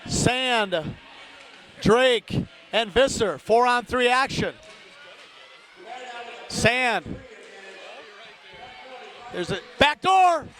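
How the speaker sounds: brisk live game call; radio hockey announcer calling the puck; hockey play-by-play. Acted. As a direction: call the action as it happens.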